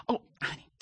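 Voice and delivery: a whispered Oh, honey. Retold or imitated. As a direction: breathe it out with no voice. Mother's breathy aside.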